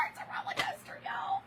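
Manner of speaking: breathless whisper through tears; It's a roller coaster whispered, voice breaking; teary whisper. Real, not acted. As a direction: whisper it as the laugh-cry takes over. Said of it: "one minute I'm crying, one minute I'm laughing".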